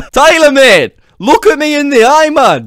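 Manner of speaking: loud, high mock-Irish demand; exasperated calling. Leprechaun demands an ignoring Tyler's attention, exasperated and loud. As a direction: call out for his attention, hammed-up.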